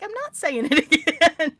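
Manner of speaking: loud, high mock protest; laughing refusal. Speaker refusing amused Westerners, loud laughing protest. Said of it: "I'm like".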